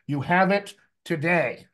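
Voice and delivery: "You have it today" spelled out slowly, falling at the end; slow down and weight "have" and "today"; slow emphatic insistence. Real, not acted. Coach telling students to hear this, slow and emphatic. Driving home the point to class.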